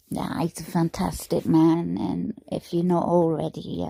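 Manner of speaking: ordinary talk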